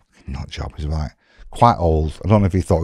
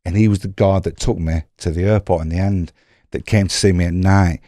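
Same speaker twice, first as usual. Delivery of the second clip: ordinary talk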